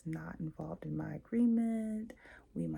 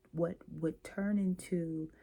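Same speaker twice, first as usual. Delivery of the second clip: ordinary delivery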